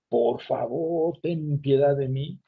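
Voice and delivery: slow, drawn-out begging with a touch of tears; mild tearful plea. Retold or imitated. Canaanite woman crying after Jesus in the street, slow tearful plea. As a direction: plead slowly like a woman in tears. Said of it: "Yo me imagino una mujer llorando, gritando".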